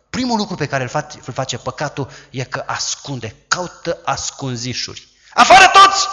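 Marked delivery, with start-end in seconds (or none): 5.3-6.1 very high, loud shout; mock shout. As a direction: bark everyone out of the room. Amnon shouts everyone out.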